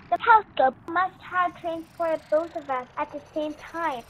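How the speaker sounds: ordinary delivery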